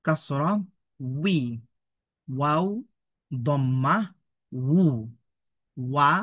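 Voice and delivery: measured chant of letter, vowel, syllable; spelling drill recitation. Shown as a demonstration. Teacher drills waw syllables for learners, measured drill recitation. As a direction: recite each piece apart, with pauses.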